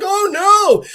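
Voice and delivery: high mock cry of alarm; panicked goblin. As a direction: squeal it like a frightened little monster. Goblin sees Carl, flees.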